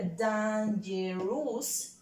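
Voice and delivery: DANGEROUS slowly split into three beats, dan-ge-rous; syllable by syllable. Shown as a demonstration. Teacher showing pupils that dangerous has three syllables, syllable by syllable. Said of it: "tiene tres sílabas".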